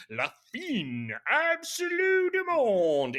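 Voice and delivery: loud, drawn-out hammed-up French title; mock-grandiose title. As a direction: blare the film title slowly with huge mock drama.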